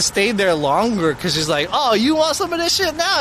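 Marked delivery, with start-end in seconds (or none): 1.7-3.2 high, taunting challenge; lightly voiced combative lady; mock confrontational. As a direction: mimic a woman spoiling for a fight. Imitating the lady picking fights.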